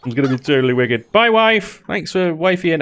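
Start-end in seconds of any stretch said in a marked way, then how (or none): 1.1-2.8 high, playful sing-song farewell; cheeky and upbeat; chirpy goodbye. Real, not acted. Cheeky farewell to new wife.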